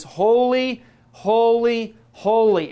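loud, high holy cry; screaming holy. Shown as a demonstration. Preacher to congregation, shouting holy. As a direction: shout the holy as Hebrew emphasis. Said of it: "of screaming at you".

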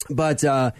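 ordinary talk, nothing marked

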